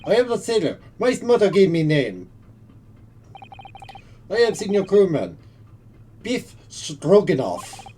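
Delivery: put-on loud sailor in a Russian accent; gruff Russian accent, proud. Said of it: "The sailor on a bad day".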